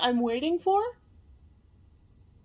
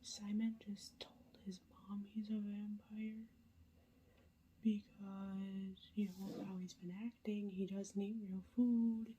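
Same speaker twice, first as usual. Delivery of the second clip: hushed late-night whisper to the camera; whispered update; 2 semitones lower, 15 dB quieter than usual. Real, not acted. Vlogger whispering a reading update at night, hushed whisper. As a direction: keep it all breathy and hushed so nobody wakes.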